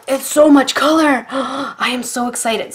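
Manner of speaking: bursting with delight; excited. Real, not acted. Gushing over new marker set.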